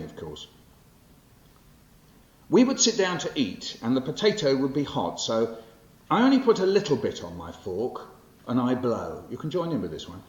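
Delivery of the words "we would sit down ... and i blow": bright, bouncy storytelling read, higher and louder; lively poem performance. Acted. Poet performing his childhood poem to the audience, lively and animated.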